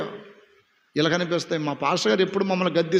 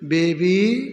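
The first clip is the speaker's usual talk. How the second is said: a put-on voice for baby with a rising end; mock cooing; 2 semitones lower, much slower than usual. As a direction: tease the sugary pet name. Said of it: "ఏం పేరండి?".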